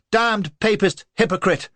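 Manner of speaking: loud, high angry yell after him; shout. A narrator's reading. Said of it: "shouted after him".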